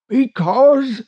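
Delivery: high distant call cut short; unseen voice, breaking off. Acted. Hidden boatman begins explaining, high halting call. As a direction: start to explain, then stop dead. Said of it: "began the voice and stopped short".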